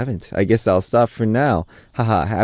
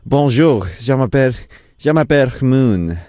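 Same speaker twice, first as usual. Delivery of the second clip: French words; 4 semitones higher, 3 dB louder than usual. Shown as a demonstration. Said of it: "I do know some French word".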